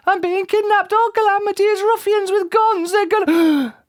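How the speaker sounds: very high hysterical squeal; screamed. A narrator's reading. Mister Jelly sure he is being kidnapped, screaming. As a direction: keep screaming in squeaky panic. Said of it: "he screamed".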